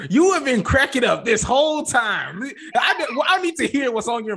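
high, loud half-laughing call-out; teasing his co-host for laughing, a loud laughing shout. Real, not acted. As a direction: crack up while calling him out, loud and high. Teasing co-host about cracking up.

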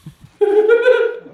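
high shrieking giggle burst; loud squealing laugh. Real, not acted.

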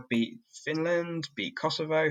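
ordinary delivery

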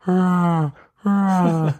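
high, drawn-out crow wail, sliding slowly down; crow's baby-like caw. Retold or imitated. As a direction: imitate a crow crying like a baby. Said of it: "it was like a voice, man".

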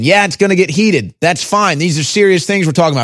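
ordinary delivery

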